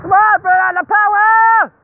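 high, loud, slow urging; excited cheering. Real, not acted. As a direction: egg the fireworks on. Cheering at fireworks.

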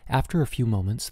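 ordinary delivery, nothing marked

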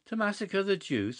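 high, shocked indignation; indignant. A narrator's reading. Huddle recoils at Clovis's plan, indignant and high. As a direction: bristle at the very idea. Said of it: "said Huddle indignantly".

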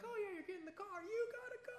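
high, excited game-show squeal; mock giveaway. Mocking the prosperity preacher, high excited giveaway voice. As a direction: squeal it like a host handing out prizes.

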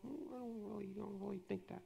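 very soft, hesitant inner murmur; little doubting voice. Acted. Preacher acts out feelings resisting agreement, little doubting voice. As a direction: murmur it as a small nagging doubt. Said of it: "if you feel like this little".

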